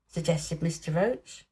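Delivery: ordinary delivery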